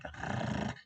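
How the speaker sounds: low growling r like an angry little dog; rolled r growled like a small dog; angry doggy growl. Shown as a demonstration. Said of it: "Like your little, little tiny doggy, that's always angry".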